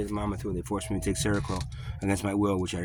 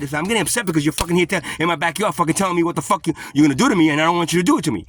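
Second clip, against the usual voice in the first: loud, fast furious retort; angry yelling; 7 semitones higher, 8 dB louder, faster than usual. Retold or imitated. Man shouts back at the cops in his backyard, loud and fast. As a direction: tear into the cops at speed.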